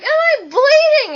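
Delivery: very high, loud panicked shriek; freaking out. Retold or imitated. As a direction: scream to your roommate in panic. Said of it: "And I was freaking out".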